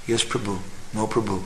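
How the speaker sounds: soft, meek yes and no; meek wife. Retold or imitated. Humble wife to her husband, soft meek replies. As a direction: answer softly like a humble wife.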